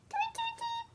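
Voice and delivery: very high tiny chirping tweets; bird tweets. Acted. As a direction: peep it like a little bird. Bird noises in story.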